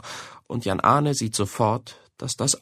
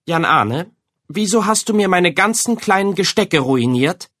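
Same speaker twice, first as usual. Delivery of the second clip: higher, louder scolding; scolding mother; 8 semitones higher, 4 dB louder than usual. A narrator's reading. Mother scolding Jan Arne over ruined decorations, angry. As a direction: tell him off for the ruined decorations. Mama scolds her son.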